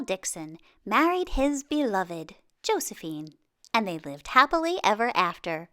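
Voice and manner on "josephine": plain reading voice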